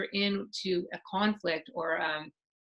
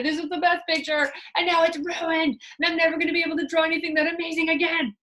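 high, louder wail over a ruined picture; child lamenting the ruined drawing to the parent, high and loud; upset child; 8 semitones higher, 6 dB louder than usual. Acted. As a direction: cry out that it's all ruined. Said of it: "they would say".

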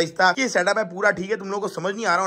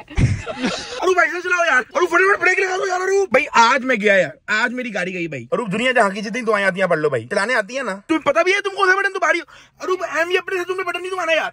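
much higher, laughing mock terror; hammed-up panic; 8 semitones higher than usual. Passenger fakes terror while his friend drives his car. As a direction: overplay the frightened passenger. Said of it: "वाह, क्या acting कर रहा है".

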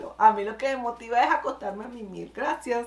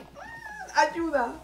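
pleading cat; 8 semitones higher, much slower than usual. Retold or imitated. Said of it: "El Michi quedó así".

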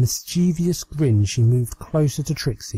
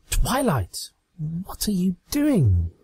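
higher, slower startled question; startled Trixie; 7 semitones higher, slower than usual. Acted. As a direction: ask as if you already know. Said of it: "she asked".

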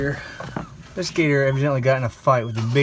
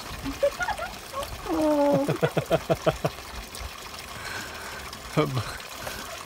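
laughing; 13 semitones higher, 6 dB quieter than usual. Real, not acted. Jokes about real men.

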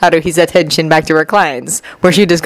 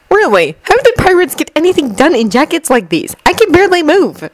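plain reading voice

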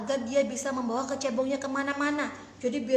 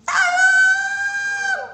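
very high, very loud scream; scream for help; 12 dB louder than usual. Acted. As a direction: shriek for help in fright.

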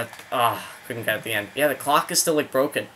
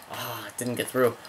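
ordinary delivery

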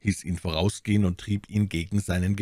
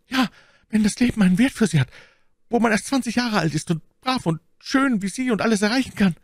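high, fast wheedling; frightened soldier in a raised voice; pleading wounded bandit; 3 dB louder, faster than usual. Acted. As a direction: flatter your captor to save your life.

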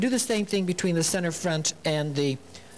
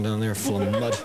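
laughing; 8 semitones lower than usual. Real, not acted. Laughs while telling.

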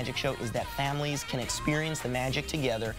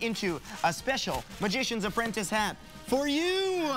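showman patter, higher and bright; kids party magician; 5 semitones higher than usual. Acted. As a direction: lead the trick like a party magician. Performing magic trick patter.

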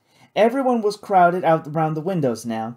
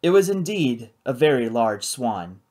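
usual speaking voice

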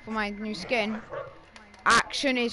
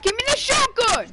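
yelling for the shotgun; 11 semitones higher, 3 dB louder than usual. Real, not acted. Yelling for a weapon.

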